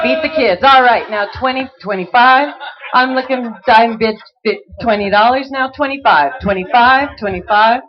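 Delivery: rhythmic bid chant; auctioneer. Acted. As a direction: keep the bids rolling fast.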